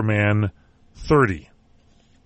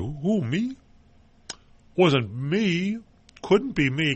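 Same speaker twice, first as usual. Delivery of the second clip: higher, softer mock-innocent voice; feigned innocence; 6 semitones higher, 5 dB quieter, much slower than usual. Host mimics sleazy actor denying the nanny affair, mock-innocent. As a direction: play the guilty man protesting innocence, small and high. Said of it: "he's got this look on his face with that beard like".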